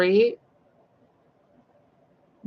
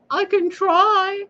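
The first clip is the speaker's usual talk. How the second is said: very high, slow, squeaky "I can try"; very high squeaky mock human, reluctant; 14 semitones higher, 6 dB louder, much slower than usual. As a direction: drag it out like a dim follower. Human replies to god.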